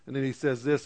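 ordinary talk

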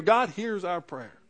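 ordinary delivery

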